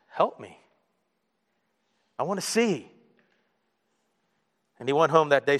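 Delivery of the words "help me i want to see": hushed, halting humble request; soft plea. Retold or imitated. Blind man answering Jesus, soft plea. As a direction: plead quietly, pausing before the wish.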